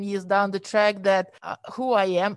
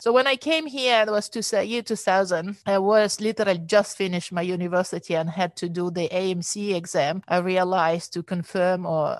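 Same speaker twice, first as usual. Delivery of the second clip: usual speaking voice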